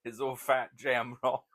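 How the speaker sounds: lively Cockney voice, laughing; Cockney impression. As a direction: imitate old George's Cockney. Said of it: "That's what he called me".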